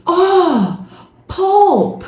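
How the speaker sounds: pulp said with a short rounded vowel and clear L; a hint of realization, pulp said right; husband reading pulp. Husband reads the carton and corrects her. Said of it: "e leu".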